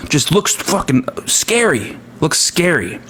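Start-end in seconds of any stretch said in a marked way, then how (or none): none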